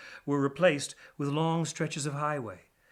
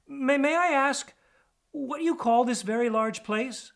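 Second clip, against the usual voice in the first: bashful stammer; 11 semitones higher, 5 dB louder than usual. Acted. Blushing DJ asks the hawk where they are, shy and high. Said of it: "blushed and tried to continue".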